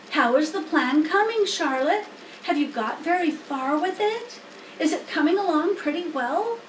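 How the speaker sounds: a worried piglet begging for hope; young pig Wilbur. Acted. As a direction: Wilbur asks the spider to save him. Wilbur voiced by reader.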